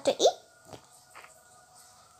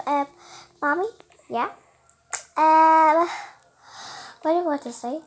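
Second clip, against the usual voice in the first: ordinary talk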